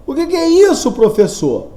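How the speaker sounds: high, loud pupil's question falling back down; puzzled student. Acted. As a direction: interrupt the teacher as a puzzled pupil. Teacher voices a puzzled student.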